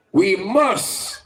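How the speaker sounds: ordinary talk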